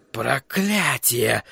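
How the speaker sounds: drawn-out curse, held in; exclaiming. A narrator's reading. Sorcerer cursing what the crystal shows, exclaiming. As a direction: stretch the curse out slowly. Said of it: "воскликнул Флэк".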